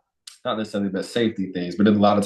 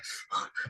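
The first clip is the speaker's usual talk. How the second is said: heavy sigh; 15 dB quieter than usual. Retold or imitated. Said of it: "I was like".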